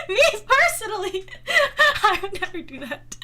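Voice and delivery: giggling through the words; amused, laugh-broken delivery. Real, not acted. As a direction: crack up while disowning it. Jokingly distances herself, laughing.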